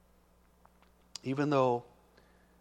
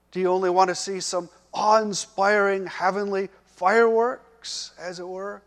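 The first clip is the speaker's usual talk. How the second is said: loud, pressing questions, higher pitch; Jesus challenging, loud; 5 semitones higher, 8 dB louder than usual. Acted. Jesus confronting the pleading father, loud and challenging. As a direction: press him hard with each question. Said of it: "In essence, he was saying".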